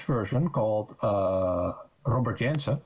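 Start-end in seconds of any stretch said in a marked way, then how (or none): none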